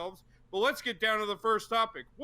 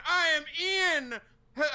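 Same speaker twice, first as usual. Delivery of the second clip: usual speaking voice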